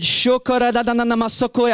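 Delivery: a quick burst of tongues; speaking in tongues. Shown as a demonstration. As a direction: rattle it off freely.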